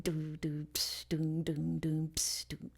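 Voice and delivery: soft mouthed kick and hiss beat; quiet beatboxed doom-pss groove; spoken drum beat. Shown as a demonstration. Teacher laying down a beat for rap lyrics, soft beatboxing. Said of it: "you'd have a beat".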